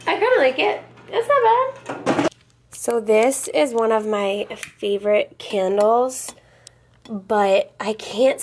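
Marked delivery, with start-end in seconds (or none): 0.1-2.3 high squeaky voice. Shown as a demonstration. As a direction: redo "It's not bad" in a high, stretched squeak.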